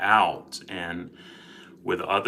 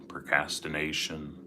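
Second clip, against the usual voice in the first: guided meditation; 7 dB quieter than usual. Acted. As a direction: gently guide listeners into calm.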